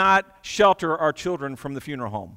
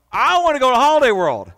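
put-on higher, louder whiny kid-like voice; mock eager wish; 7 semitones higher, 8 dB louder than usual. Preacher mimicking someone who prefers the amusement park, eager whine. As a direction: play the eager child begging for fun. Voicing a pleasure-seeker's wish.

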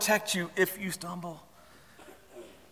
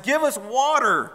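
high, drawn-out mock demand; quarrelsome demand; 8 semitones higher, slower than usual. Israelites quarrel with Moses, demanding in a high voice. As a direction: whine it at the leader. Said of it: "They, they quarreled with Moses".

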